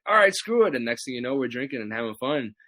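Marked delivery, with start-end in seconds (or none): 0.1-0.7 higher, louder eager give-in; giving in. Retold or imitated. Agreeing to drink with Jericho: higher, louder eager give-in. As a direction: throw caution away, grinning. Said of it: "I'm like".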